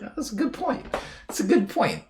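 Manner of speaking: high, soft lilting aha; mock realization. Passenger answering the cab driver, high mock realization. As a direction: play it like a sudden dumb epiphany. Self in retold cab ride.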